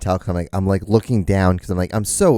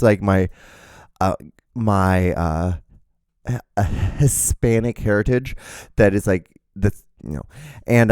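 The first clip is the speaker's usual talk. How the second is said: normal talking voice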